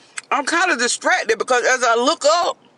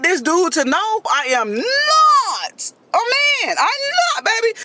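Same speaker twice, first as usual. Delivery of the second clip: desperate insistence; 6 semitones higher, slower than usual. Retold or imitated. Desperate to prove she's female.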